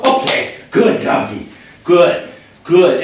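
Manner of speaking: reward him with eager praise; praising the dog. Real, not acted. Trainer praising her dog for going in, eager praise.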